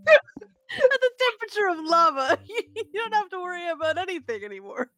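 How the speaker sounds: talking through real laughter; helpless laughter. Real, not acted. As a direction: tease while cracking up, voice breaking with laughs. Cracking up while teasing the cook.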